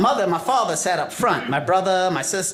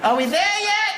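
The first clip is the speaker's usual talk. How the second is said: put-on high kid voice whining from the back seat; little boy pestering his parents; whiny kid; slower than usual. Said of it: "That was my job".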